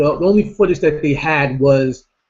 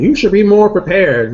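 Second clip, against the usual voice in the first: higher, louder mock scold from the audience; mocking nagging viewers; 6 semitones higher, 8 dB louder than usual. Vlogger mimics viewers scolding him, higher and louder. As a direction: parrot the viewers' complaint. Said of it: "I already hear you people giving me feedback".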